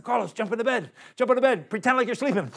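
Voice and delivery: urgent order. Retold or imitated. Urgent whisper-order to cellmate.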